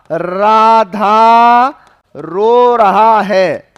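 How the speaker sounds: each word drawn out very slowly and loud; slow dictation. Shown as a demonstration. Teacher dictating a sentence to students, very slow and loud. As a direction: dictate it slowly to the class so they can copy. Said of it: "चलो, मैंने लिखा".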